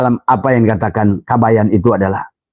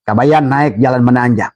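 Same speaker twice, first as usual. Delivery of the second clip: normal talking voice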